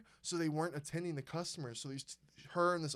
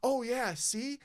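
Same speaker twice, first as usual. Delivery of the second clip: mocking Karen; 7 semitones higher, 6 dB louder, slower than usual. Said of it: "she goes".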